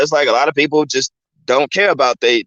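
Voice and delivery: ordinary delivery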